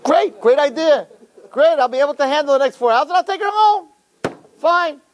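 high-pitched, mock-elated outburst; hammed-up enthusiasm. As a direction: rattle off the plan in high excitement. Acts date's delight at plan.

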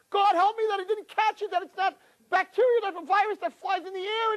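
high, frantic yelling of a terrified man; panicked scream. Retold or imitated. Man fleeing the sick woman, screaming in high panic. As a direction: scream it like you're fleeing for your life. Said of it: "he's screaming".

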